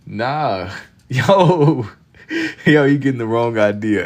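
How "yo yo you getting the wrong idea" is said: laughing. Real, not acted. Laughing reaction to chat.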